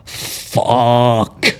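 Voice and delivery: "Fuck" stretched long to a hard K; dragged-out curse. Acted. Belfast mum finishing her rant at her child, "Fuck" dragged right out. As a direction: drag out one exasperated "Fuck". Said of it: "Like, they drag it out".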